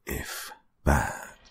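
unvoiced, menacing warning; whispered warning. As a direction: warn it in a whisper. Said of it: "the voice warned".

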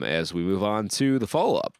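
ordinary talk

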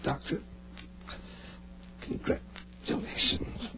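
faint, broken words; dying gasps. Acted. Dying Ed gasps to David, faint broken words. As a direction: fade out with each breath.

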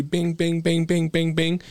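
rapid string of high bings; imitated app pings. Showing friends how likes pile up, imitated high pings. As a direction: mimic a phone flooded with notifications.

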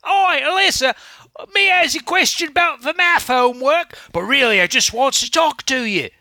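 a hammed-up cockney kid calling his crush; Cockney urchin impression. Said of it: "do your impression of him".

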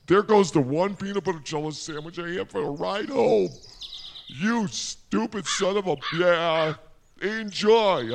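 loud outraged yelling at the bird; angry yelling. Real, not acted. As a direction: rage at the thief who took lunch. Bird steals his lunch.

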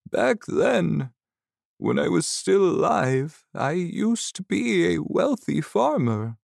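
put-on high, slow old man's voice. Old ghost telling Seneth he must help, old man voice. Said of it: "He had the voice of an ordinary old man".

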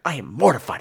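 whispered confession; whispered so the audience can't hear. Real, not acted. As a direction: hush it like in a theater. Whispered aside about embarrassment.

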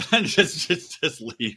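normal talking voice

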